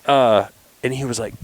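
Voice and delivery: normal talking voice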